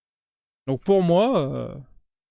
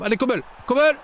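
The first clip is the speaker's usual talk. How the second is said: calling out to a player on screen, excited; excited cheering; 9 semitones higher, faster than usual. Real, not acted. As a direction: cheer him on, pitch climbing. Urging player during match.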